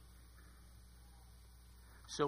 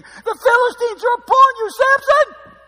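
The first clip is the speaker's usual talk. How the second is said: much higher, loud cry of warning; alarmed shout; 22 dB louder than usual. Acted. Delilah shouting to Samson that enemies are upon him, alarmed shout. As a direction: yell it like springing a trap.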